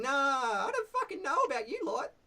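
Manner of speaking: brash goblin challenging strangers at the bridge. Shown as a demonstration. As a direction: squeak it out like a rude little goblin guard. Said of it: "he's like".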